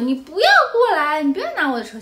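put-on high, loud child's voice, shooing him off; a possessive little girl guarding her toy; imitating a bratty little girl. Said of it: "小女孩就说". (模仿小女孩尖声护着车)

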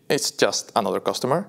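ordinary delivery

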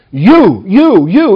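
each YOU struck separately; stress on YOU. Shown as a demonstration. Preacher singling out individuals, stress on each YOU. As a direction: jab out "you", one listener at a time. Demonstrates Paul's string of singular yous.

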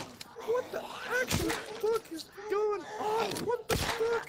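high, drawn-out disbelief; outraged, can't believe it; shocked disbelief. Real, not acted. Baffled by game glitch.

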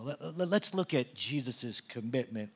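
ordinary delivery

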